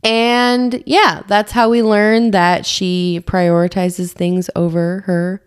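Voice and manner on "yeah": ordinary talk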